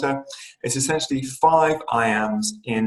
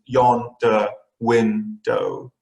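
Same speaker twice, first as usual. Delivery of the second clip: "yonder window" very slow, as YON-der WIN-dow; drawn-out "yonder window", a beat on each syllable; much slower than usual. Shown as a demonstration. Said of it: "it goes by syllables".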